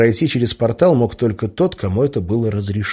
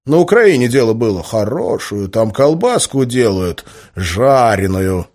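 hearty storyteller Semyon; 5 semitones higher, 5 dB louder than usual. A narrator's reading. Semyon recalls fattening up a stray boy, hearty relish. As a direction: reminisce with gusto, stretching the tasty words.

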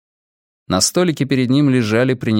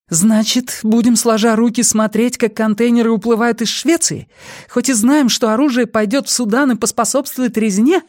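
high-pitched indignant outburst; outraged protest on a raised, strained pitch; almost shouting; 14 semitones higher, 3 dB louder than usual. A narrator's reading. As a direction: nearly shout it, voice held high throughout. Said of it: "Сага почти кричала".

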